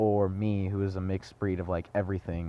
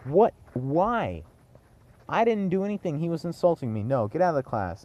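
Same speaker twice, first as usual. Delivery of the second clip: higher, outraged disbelief; student protesting to the teacher, outraged pitch rising; indignant protest; 5 semitones higher than usual. Retold or imitated. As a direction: act wronged and baffled at the teacher. Protesting teacher's unfair blame.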